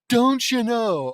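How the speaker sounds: loud, high, slow Minnesota drawn vowels; hammed-up Minnesota catchphrase, stretched out; exaggerated Minnesota accent. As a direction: belt out an over-the-top Minnesota accent. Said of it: "Minnesota accent?".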